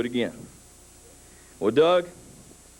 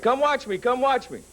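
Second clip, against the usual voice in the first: put-on kid calling out; 11 semitones higher than usual. Kid brother calling from roof.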